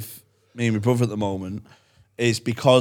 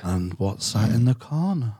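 ASMR voice; slower than usual. Shown as a demonstration. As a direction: lull her to sleep slowly. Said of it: "So I just do that A S M R voice".

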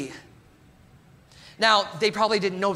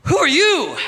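mock snub; 4 semitones higher, 5 dB louder than usual. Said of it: "I felt like doing like".

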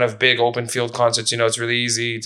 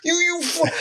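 much higher, cartoonish teasing voice; mocking drinking buddies. Drinking buddies mocking Frank for stopping at a few beers, higher mocking voice. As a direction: ham up a pushy friend heckling.

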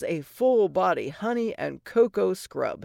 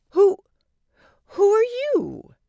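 put-on high, slow, startled young woman; a frightened princess asking who's there; startled Cinderella; 14 semitones higher, 6 dB louder than usual.

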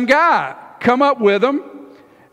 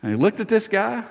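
usual speaking voice

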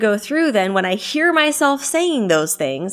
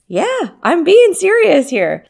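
ordinary talk, nothing marked

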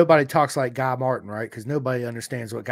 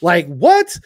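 loud, high laughing disbelief; astonished; 12 semitones higher, 9 dB louder than usual. Retold or imitated. Dad reacting to his son's sudden accent, mock astonishment. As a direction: retell your shock, loud and pitched up.

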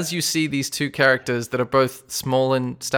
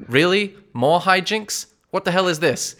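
higher, fed-up disbelief; exasperated; 6 semitones higher, 3 dB louder than usual. Acted. Scroungemore fed up with the party's antics, exasperated higher voice. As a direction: roll your eyes at more nonsense. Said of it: "this look on Scroungemore's face that says".

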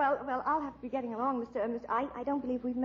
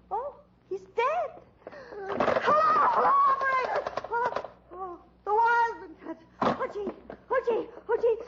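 higher, breathless panic with a catch of crying; panicked fright; 7 semitones higher than usual. Acted. Reporter alone with the corpse, higher panicked near-crying. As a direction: scramble in terror, near tears.

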